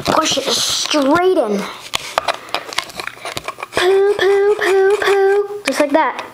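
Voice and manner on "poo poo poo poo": blaster sounds. Pretend. Toy blaster fire sound effects.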